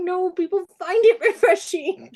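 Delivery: high-pitched, words squeezed through laughter; barely holding back a laughing fit; helpless laughter. Real, not acted. Author retells her embarrassing reply, cracking up.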